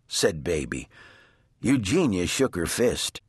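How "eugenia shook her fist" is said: plain reading voice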